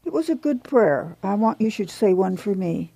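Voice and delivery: soft, tender sickbed insistence; hushed request from a frail woman; quiet plea. A narrator's reading. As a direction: coax very softly, saving breath. Sick woman pleads for prayer.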